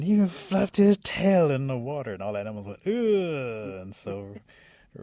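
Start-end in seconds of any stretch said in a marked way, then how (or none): 0.0-2.1 loud, high-pitched climax of outrage; scandalized. Acted. Raccoon adds the worst detail to the animals, scandalized. 2.9-3.8 loud, high groan sliding down; disgusted groan. Acted. Animals hear about the tail in the water, disgusted groan.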